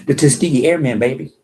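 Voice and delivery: loud and proud, "Tuskegee Airmen" called out; proud loud declaration. Real, not acted. Telling viewers about his forefathers, loud and proud. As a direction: boom the name out with pride. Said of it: "Let me say that again".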